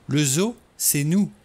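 "Le zoo, c'est nous" slow, dark, weighted on "nous"; slow grim repeat. A narrator's reading. Vera repeating her grim conclusion to the men, slowly. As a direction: let it sink in slowly.

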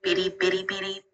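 half-hearted cartoon robot babble; Tweaky. Shown as a demonstration. Assistant asked to start like a TV robot, weak robot imitation. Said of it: "start each thing with beady beady beep like Tweaky did".

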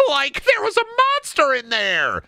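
very high, frightened cartoon squeal; Shaggy. Shaggy reports a monster, panicky squeal. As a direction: yelp it like a goofy, scared sidekick. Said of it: "Shaggy, you fucking idiot".

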